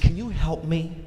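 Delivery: lightly voiced wife seeking attention; wife asking for help. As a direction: ask for help, rising at the end. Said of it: "she's trying to find his frequency".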